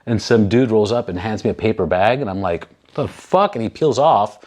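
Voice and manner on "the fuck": baffled. Retold or imitated. Stranger hands paper bag.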